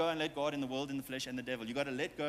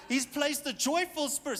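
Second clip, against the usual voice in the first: preaching emphasis; 9 semitones higher, 3 dB louder than usual. Real, not acted. Preaching rise.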